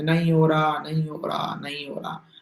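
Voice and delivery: low, tearless mock moan, dying away; mimicked defeated whimper; mock whining students. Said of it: "रोया PT मच मचाओ class में".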